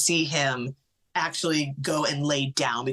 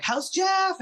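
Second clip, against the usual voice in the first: high cheery sister. Retold or imitated.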